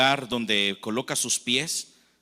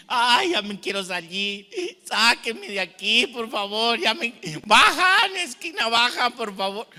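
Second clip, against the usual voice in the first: panicked pleading; 8 semitones higher, 5 dB louder than usual. Retold or imitated. Trapped claustrophobic begging the people around him, panicked. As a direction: beg to get out as if trapped and suffocating.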